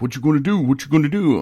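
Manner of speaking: high, loud mocking taunt; taunting guy. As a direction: mimic the guy squaring up in his face. Angry guy getting in face.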